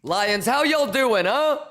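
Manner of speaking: big slow shouted hello; loud hype greeting. Real, not acted. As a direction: welcome the crowd loudly. Greeting the stream audience.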